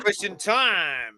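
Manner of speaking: slow announcer call of "Question time"; slow mock announcer; announcing the segment. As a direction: drag out "Question time" like a show jingle.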